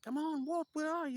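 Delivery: high, loud put-on summons; impatient knight calling. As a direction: call out for a lagging squire. Voices knight calling his squire.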